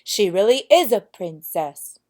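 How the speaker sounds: loud, triumphant cry; the prince proves his mother wrong with joy; jubilant, loud exclamation; happy prince. A narrator's reading. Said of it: "said the happy prince".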